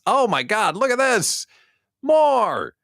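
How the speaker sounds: high, loud excited shriek; excited squeal. Real, not acted. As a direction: squeal with delight. Excited over two new clients.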